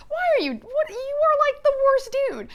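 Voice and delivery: high-pitched, sputtering reproach; outraged scolding. Retold or imitated. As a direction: berate him in squeaky disbelief. Acting everybody scolding Milos.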